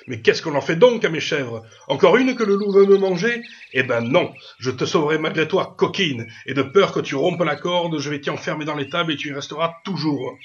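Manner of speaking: loud, high, indignant outburst; exasperated scolding. A narrator's reading. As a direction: scold her at full voice. Master scolds, locks goat up. (gronderie indignée, à pleine voix)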